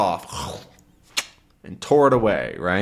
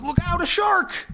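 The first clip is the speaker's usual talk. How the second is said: much higher, sudden cry of alarm; alarmed warning. Acted. Tintin warning Haddock of the shark, high alarmed cry. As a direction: warn him the shark is coming. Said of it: "And then Tintin says".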